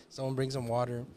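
ordinary talk

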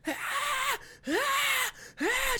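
high mock labor cries; mock screaming. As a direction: imitate her screaming in pain, pitched high. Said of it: "who was screaming, like".

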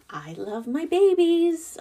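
I love my babies said high and loud, stretched out on babies; gushing, high and loud. Real, not acted. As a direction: swoop up high and gush over the babies. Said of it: "Don't get me wrong".

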